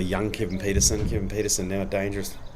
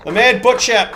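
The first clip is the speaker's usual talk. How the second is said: much higher and louder, the name shouted in triumph; excited commentary yell; 12 semitones higher, 10 dB louder than usual. Acted. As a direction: roar the bowler's name after the wicket.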